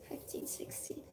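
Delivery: breathy whisper under her breath; a sheepish whispered correction; whispered aside. Real, not acted. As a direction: whisper it as if confessing. Embarrassed aside about her age.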